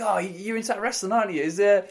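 high, loud put-on voice of a clueless punter; excited mock fan. Acted friend asking about wrestling.

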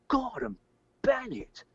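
high, startled Gordon Bennett; astonished exclamation. Retold or imitated. Man recalling seeing UFOs, high astonished exclamation. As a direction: blurt it out as the objects appear. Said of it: "I thought".

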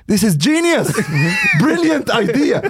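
very high, gushing imitation; hammed-up, high-pitched enthusiastic approval; mock excitement. Imitating a friend praising the burger idea, wildly excited.

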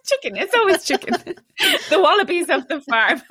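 high-pitched, giggling through the words; real laughter bubbling over a silly joke; laughing. Real, not acted. Cohosts joke about substituting for wallaby, laughing.

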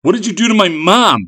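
high, loud, distressed demand; imploring. A narrator's reading. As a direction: implore them desperately. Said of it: "he implored".

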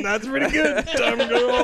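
high, laughing approval; mock-impressed chuckle; amused praise. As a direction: praise her through a laugh. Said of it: "I'm like".

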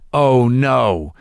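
normal talking voice

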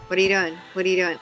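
ordinary talk

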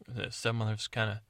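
ordinary talk

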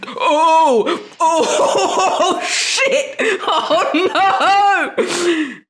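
high, laughing, embarrassed wail; laughing in horror. Retold or imitated. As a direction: crack up while groaning oh no. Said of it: "I was like".

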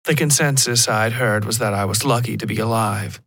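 usual speaking voice

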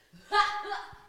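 booming laugh with the head thrown back; loud guffaw. Shown as a demonstration. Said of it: "I do a guffaw".

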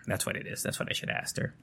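whispering to himself, unvoiced; whispered aside. Real, not acted. Vlogger whispering to himself while asking Alexa. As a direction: breathe it out as a note to self.

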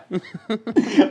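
loud, high laugh; real laughing; burst of laughter. Real, not acted. Host laughs at feedback.

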